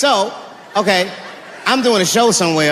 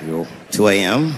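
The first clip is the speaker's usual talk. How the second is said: much lower, tired cop voice; weary police veteran; 10 semitones lower, 3 dB quieter than usual. Acted. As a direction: sigh through the report like a jaded officer. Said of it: "a police veteran taking this kid's police report".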